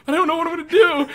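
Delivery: high, loud, rushed mock fretting; mock panic. Actress to departing voice coach, hammed-up panic. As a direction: blurt it in comic panic.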